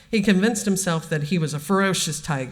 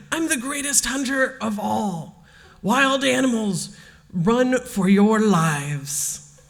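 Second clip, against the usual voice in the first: high, triumphant storybook cry, slowing at the end; gleeful shout; 7 semitones higher, 3 dB louder, slower than usual. A narrator's reading. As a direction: call out like a boy playing mighty hunter, drawing out the last words. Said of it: "shouting with glee".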